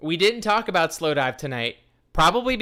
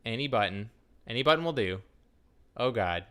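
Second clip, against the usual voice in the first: ordinary talk, nothing marked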